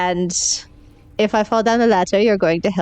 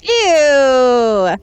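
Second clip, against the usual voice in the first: disgusted; 3 semitones higher, 5 dB louder than usual. Acted. Disgust at hands in cask.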